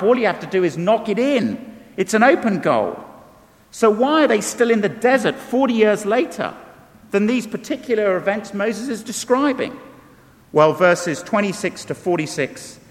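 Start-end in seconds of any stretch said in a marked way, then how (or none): none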